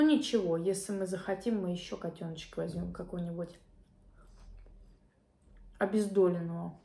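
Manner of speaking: talking between bites; chewing food while speaking; mouth full chewing. Real, not acted. Seen 0: lips pursed chewing, bites food at 4.1, keeps chewing.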